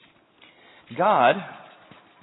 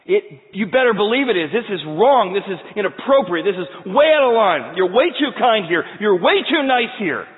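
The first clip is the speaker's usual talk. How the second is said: loud, higher, punching each way; indignant Jonah; 7 semitones higher, 9 dB louder than usual. Acted. Preacher acting Jonah's loud protest that God is too nice.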